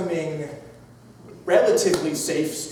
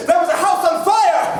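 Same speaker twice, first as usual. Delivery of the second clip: high, shouted story alarm; mock alarm; 7 semitones higher than usual. Storyteller spotting a fire in the distance, mock alarm. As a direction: cry out the emergency with hammed-up drama.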